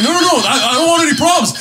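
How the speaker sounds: high, loud retold protest; mock backing down. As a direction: imitate someone hurriedly backing out of a fight. Retold man backing down in argument.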